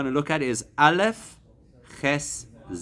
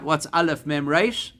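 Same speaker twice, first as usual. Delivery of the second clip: ordinary talk, nothing marked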